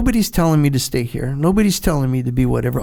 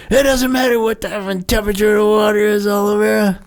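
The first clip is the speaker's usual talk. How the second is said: raised, pitched-up defiant retort; defiant answer; 6 semitones higher than usual. Retold or imitated. Freezing trainee shouts his defiant answer at the instructor. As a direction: throw the answer back at him, defiant.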